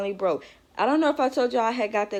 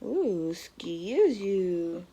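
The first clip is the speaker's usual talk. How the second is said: slow, soft playful tease; 4 dB quieter, much slower than usual. Real, not acted. Mom teasing her hiccuping baby, soft and slow.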